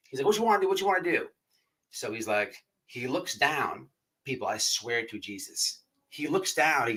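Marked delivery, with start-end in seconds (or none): 0.3-1.3 put-on high, eager country-accent voice; big country accent. Inmate asking what he must do for dip, country accent. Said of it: "he had this big old country accent".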